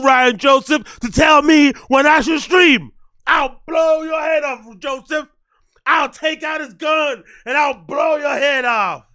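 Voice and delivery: loud, furious shouting at a chatter; hammed-up furious tirade at Ryan Joseph; mock rage rant. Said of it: "Apologies for the N-bombs".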